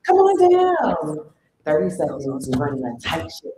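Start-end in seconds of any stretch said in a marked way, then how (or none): 0.1-1.3 game show call. Real, not acted. Host calling presenters up.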